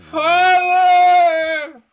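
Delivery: high, loud wail calling up from below; starving ghostly son shrieking for his father; desperate shout. Acted. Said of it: "is shouting".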